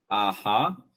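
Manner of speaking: normal talking voice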